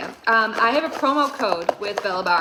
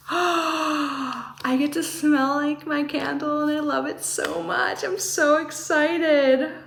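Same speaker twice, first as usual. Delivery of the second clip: gasping delight; 5 semitones higher, slower than usual. Real, not acted. Gasping over the matching oil.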